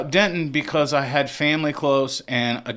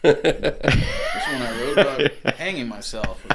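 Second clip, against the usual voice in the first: slow, amused mock intro; laughing dark joke; 4 semitones higher, 4 dB louder, slower than usual. Singer jokes about his sad songs, laughing slowly. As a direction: chuckle through the gag. Joking strip club scene.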